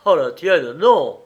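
loud, vehement outburst; exclaimed refusal. A narrator's reading. Husband rejects his wife's life, loud cry. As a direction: fling the refusal out loudly. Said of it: "s'écria-t-il".

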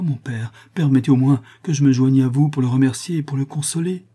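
put-on high, pleading young girl voice; frightened daughter. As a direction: beg her father not to leave.

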